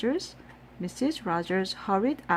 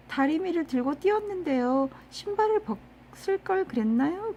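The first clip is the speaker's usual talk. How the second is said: put-on childlike innocent; 10 semitones higher, much faster than usual. Retelling Amelia's line in Korean.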